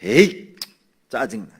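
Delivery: loud huff, then dropping off; mock annoyed grumbling. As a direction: imitate someone quick to complain. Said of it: "원망부터 해". (짜증 내며 투덜대는 흉내)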